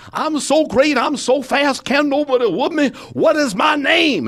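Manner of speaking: high, loud boastful shout; Muhammad Ali. Retold or imitated. Champ bursting in on nursing home residents, high loud boasting. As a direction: crow it like a champion showing off. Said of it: "I interviewed Muhammad Ali".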